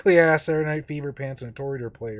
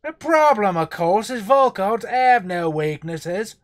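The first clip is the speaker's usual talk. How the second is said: put-on a reading in a loud, high, slow, botched Cockney accent; attempted Cockney; 6 semitones higher, 7 dB louder, slower than usual. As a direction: try the Cockney and drift into American.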